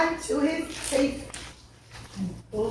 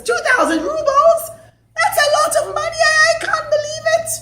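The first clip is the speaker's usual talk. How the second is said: much higher, incredulous squeal; shocked outburst; 5 dB louder than usual. Acted. Rich father hearing the compensation, shocked squeal. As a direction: gasp at the price.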